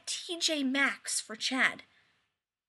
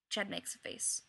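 ordinary delivery

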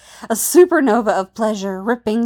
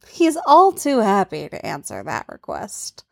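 ordinary delivery, nothing marked

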